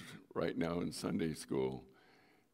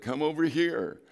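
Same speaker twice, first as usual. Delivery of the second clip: raised, higher call across the pool; father calling out; 7 semitones higher, 6 dB louder than usual. Retold or imitated.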